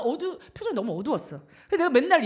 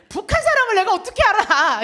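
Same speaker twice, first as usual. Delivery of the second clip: louder and higher, laughing through it; laughing protest at the mother's question; laughing reply; 8 semitones higher, 13 dB louder than usual. Retold or imitated.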